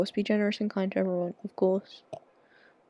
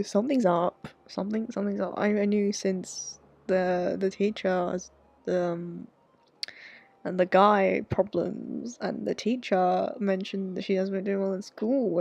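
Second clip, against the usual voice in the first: normal talking voice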